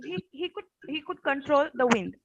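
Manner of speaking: ordinary talk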